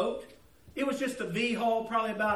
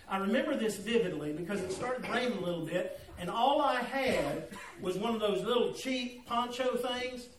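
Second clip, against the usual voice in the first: ordinary delivery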